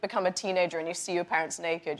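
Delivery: usual speaking voice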